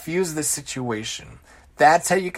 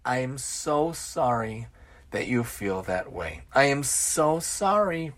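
slow, soft and much lower; calm apology; 4 semitones lower, 6 dB quieter, slower than usual. Acted. Calm employee answering the screaming boss, soft apology. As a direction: stay calm and apologize gently. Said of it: "respond in a very calm manner".

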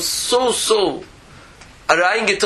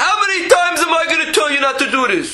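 exasperated scolding; 10 semitones higher, much faster than usual. Pretend. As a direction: scold like a fed-up parent. Acted angry parent scolding.

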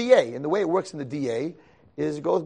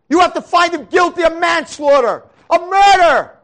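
much higher and louder, slow, declaiming shout; prosecutor's fiery summation; 13 dB louder, slower than usual. Retold or imitated. Young prosecutor shouting his closing at the jury, slow and loud. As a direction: thunder at the jury like a courtroom prosecutor.

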